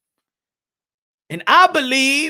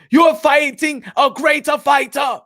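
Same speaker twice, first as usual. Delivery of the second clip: shout; 3 semitones higher than usual. Acted. Hyping a fighter before his big chance, shouting. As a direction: pump him up, shout it out.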